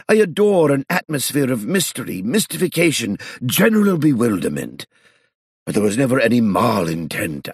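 put-on high, fussy apologetic old gentleman; pleading old man. A narrator's reading. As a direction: wheedle like a fussy old aristocrat. Old man pleads his case.

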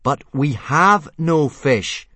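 slow, loud, deliberate refusal; firm but pleasant. A narrator's reading. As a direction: spell it out firmly, trying to dominate. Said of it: "make his voice sound firm but pleasant".

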